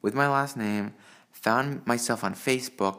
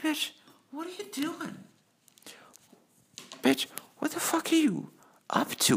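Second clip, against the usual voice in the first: high strained disbelieving voice, half whispered; incredulous mock voice. Reacting to the blackmailer, high incredulous strained voice. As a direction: squeak it out in disbelief.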